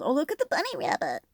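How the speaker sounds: light up at the bunny in the picture; delighted. Real, not acted.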